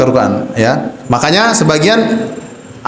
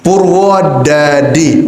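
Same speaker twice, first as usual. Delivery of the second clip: slow light Purwodadi without Javanese accent; Quranic clean pronunciation; much slower than usual. Shown as a demonstration. Teacher shows students a Purwodadi with no Javanese accent.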